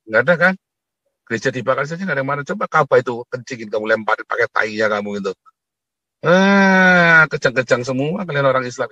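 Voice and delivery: loud, heated harangue at the audience; angry tirade; fierce ranting. Real, not acted. Escalating rant.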